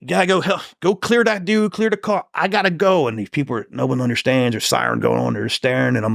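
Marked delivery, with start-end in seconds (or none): 0.1-3.1 retold urgency, pitched high; urgent orders. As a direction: re-enact urgently sending someone to take over. Retold urgent orders at shooting scene.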